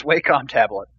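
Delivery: "Wacom" said as WAY-com; first syllable of "Wacom" rhymes with "day"; alternative pronunciation. Shown as a demonstration. Co-host correcting the name, "Wacom" as WAY-com. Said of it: "I think it is".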